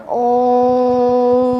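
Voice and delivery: ओम held very long and low; drawn-out om. Shown as a demonstration. Teacher holding ओम to show a pluta vowel. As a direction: chant ओम, stretching it far. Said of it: "देखो कितना समय लग रहा है".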